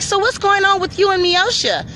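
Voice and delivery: high, put-on prying official voice; fake-sweet nosy question; mock caseworker. Voicing the caseworker.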